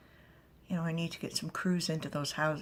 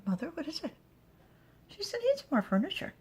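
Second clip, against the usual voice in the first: higher character voice; high daughter voice; 12 semitones higher, faster than usual. Acted. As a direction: play the younger woman asking.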